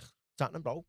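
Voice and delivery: a chirpy hello to a stranger; retold greeting. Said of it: "I'm like".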